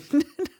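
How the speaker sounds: high giggle at own attempt; self-mocking high laugh. Real, not acted. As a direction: giggle at your own bad accent. Laughs at own failed accent attempt.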